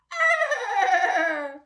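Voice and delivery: very high loud trumpeting; elephant trumpet. Pretend. Teacher trumpeting like an elephant for children. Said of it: "The elephants of the jungle say".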